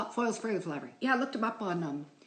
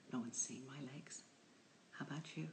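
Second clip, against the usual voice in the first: whisper; 4 semitones lower, 13 dB quieter than usual. Real, not acted. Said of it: "confiding aside".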